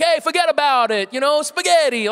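put-on loud, high caricature New York accent; mock New Yorker accent. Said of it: "us talking about New Yorkers".

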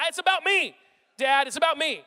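put-on sulky nine-year-old digging in; whiny kid. Nine-year-old son answering his dad about clipping down, whiny. Son's selfish inner voice.

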